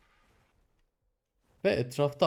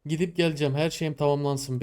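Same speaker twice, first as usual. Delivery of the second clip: usual speaking voice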